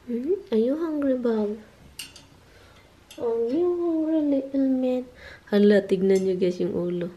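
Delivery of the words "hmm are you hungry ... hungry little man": high sing-song cooing question. Real, not acted. Parent asking little man if he's hungry, baby talk. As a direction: ask sweetly in a raised voice.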